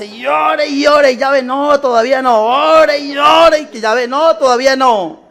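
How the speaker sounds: high, loud back-and-forth of prayer and reply; insistent mock praying. Oración insistente y ciego que responde. (imitando oración insistente, aguda y fuerte)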